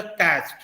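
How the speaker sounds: कैच्ड ending in a voiceless "t", like "catcht". Shown as a demonstration. Teacher modelling the correct ending, कैच्ड with a t. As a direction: close कैच्ड on a released t. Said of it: "वो ट में एंड करेगा".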